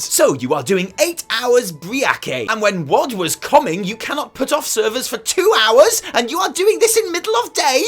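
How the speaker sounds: hammed-up complaint, hitting every word hard; mock outraged rant. Player berating the developers over server downtime, mock outraged. Reading out irate forum complaints.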